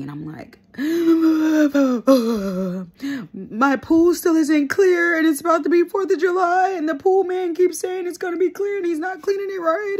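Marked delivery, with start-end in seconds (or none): 0.8-3.3 drżący, płaczliwy wdech; złap powietrze jak przed szlochem; crying gasp. Retold or imitated. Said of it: "retells calling boyfriend crying". 3.3-10.0 wysoki, zapłakany lament; zawodź to wyżej przez łzy; whiny crying. Retold or imitated. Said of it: "I call my boyfriend crying".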